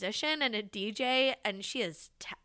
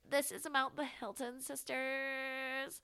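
slow, a bit higher, through the nose; nasal voice; 4 semitones higher, 5 dB quieter, slower than usual. Pretend. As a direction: do a silly nasal voice. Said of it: "It's, like, really nasally".

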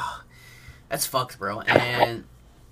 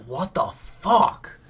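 imitating shocked friend; 7 semitones higher, 5 dB louder, much slower than usual. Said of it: "Aiden was just like".

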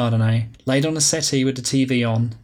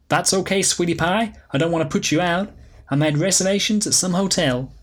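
higher, breezy flamboyant woman; Alicia, breezy diva; 6 semitones higher than usual. Acted. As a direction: sweep in like a glamorous old flame.

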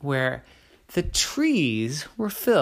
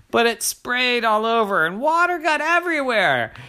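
ordinary delivery

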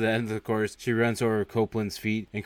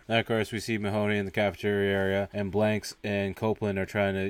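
ordinary talk, nothing marked

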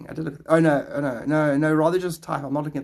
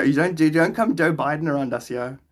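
ordinary talk

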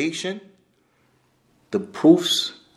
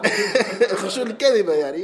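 words broken by real laughter; laughing; 7 semitones higher than usual. Real, not acted. Teacher laughing at fake humility while naming it. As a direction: laugh through the phrase, slowly.

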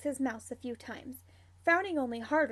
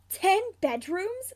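slow, high, louder wondering question; musing; 7 semitones higher, 7 dB louder, slower than usual. A narrator's reading. Sirius browses houses with James, musing. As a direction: stretch it out, rising in wonder. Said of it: "Sirius muses".

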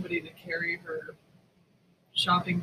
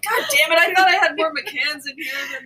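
higher, louder, indignant whine; mock snob complaint; 7 semitones higher, 6 dB louder than usual. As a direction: pout over the missing whisky. Continues mock rich complaint.

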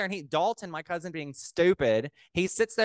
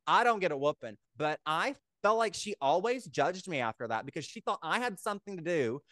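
ordinary talk

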